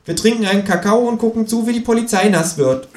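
high cheeky kangaroo. Acted. Kangaroo brushing off the complaining narrator, high and cheeky. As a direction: snap back high as the know-it-all kangaroo.

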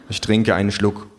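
ordinary delivery

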